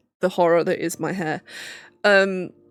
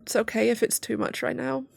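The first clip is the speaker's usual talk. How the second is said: softer and higher, reassuring herself; softly reassuring, higher; gentle reassurance; 5 semitones higher, 5 dB quieter than usual. Real, not acted. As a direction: comfort yourself gently. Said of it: "if I was giving this advice".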